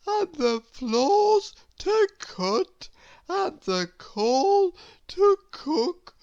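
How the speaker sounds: high, very slow, sleepy and mixed up; dazed muddled voice. A narrator's reading. Mister Lazy in a daze muddling up every chore, slow and high. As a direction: drift through the list half asleep. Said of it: "groaned Mister Lazy in a daze".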